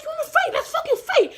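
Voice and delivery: very high-pitched challenge to fight; re-enacted angry screech; screaming. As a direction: yell it high, spoiling for a fight. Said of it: "And I'm screaming".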